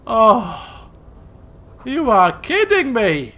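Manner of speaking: loud, slow disbelief; loud exasperated shout; real exasperation. Real, not acted. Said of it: "player disbelief".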